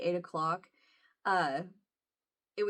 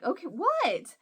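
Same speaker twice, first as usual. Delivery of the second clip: curious surprise; 8 semitones higher, slower than usual. Retold or imitated. Retells eager question to daughter.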